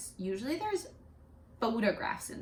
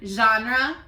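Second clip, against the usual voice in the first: genre said clearly and loudly for repeating; teacher modelling the word genre; modelled word; 9 dB louder than usual. Shown as a demonstration. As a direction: pronounce genre slowly and clearly for the class. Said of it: "I'll say it again".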